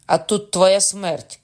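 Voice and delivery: slow, loud cry; vengeful shout. A narrator's reading. Mother at the window threatening Cocona, shouting. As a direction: raise the voice and draw out the threat. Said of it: "крикнула мати з вікна".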